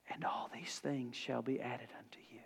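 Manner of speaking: hushed, breathy scripture whisper. Acted. Preacher whispering Jesus' words to the congregation, hushed. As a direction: let the promise fall to a whisper.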